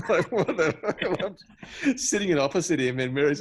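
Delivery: high, loud words breaking into laughter; laughing disbelief. Real, not acted. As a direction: splutter it through real laughter. Laughing at the awkward memory.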